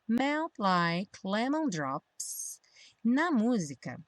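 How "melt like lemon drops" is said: slow, linked model phrase; pronunciation practice. Shown as a demonstration. Teacher modelling lyrics for learners, slow pronunciation practice. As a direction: draw the phrase out smoothly for learners. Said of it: "pra prática da pronúncia".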